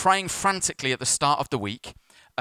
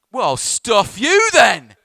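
high, loud, drawn-out comic yell; hammed-up huff, stretching the words out; indignant outburst; 8 semitones higher, 10 dB louder, much slower than usual. Pastor reacts to thankless drivers, hammed-up indignant outburst.

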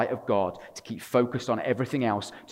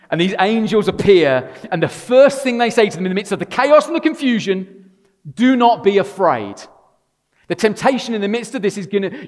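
loud, high preaching; preaching at full force; 9 semitones higher, 9 dB louder than usual. Acted. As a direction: proclaim the angels' message.

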